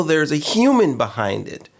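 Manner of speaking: ordinary delivery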